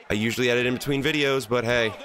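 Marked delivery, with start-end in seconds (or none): none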